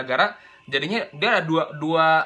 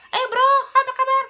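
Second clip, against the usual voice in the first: much higher, louder squeaky greeting; high-pitched voice; 5 dB louder than usual. Retold or imitated. Teacher imitates a high-voiced student greeting a friend. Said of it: "suara dia".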